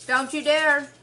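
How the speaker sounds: sudden loud warning; sharp loud outburst. Real, not acted.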